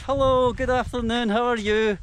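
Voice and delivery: high, lightly voiced villager calling hello; mock villager greeting. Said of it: "They're like".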